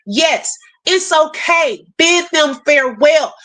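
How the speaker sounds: usual speaking voice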